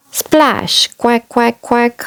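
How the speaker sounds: high squeaky quacks; duck quacking. Acted. Duck noises in story.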